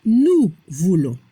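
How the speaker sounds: "nous voulons" slow and low, nasal ON, silent S; pronunciation model. Shown as a demonstration. Teacher showing learners the nosotros form, slow low "nous voulons" with nasal ON. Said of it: "La pronunciación".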